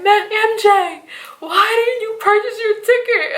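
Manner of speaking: high, exasperated scolding of a friend; high fretful "why didn't you" to a friend; stressed friend. Retold or imitated. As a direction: whine it high with worry. Stressed man scolds her.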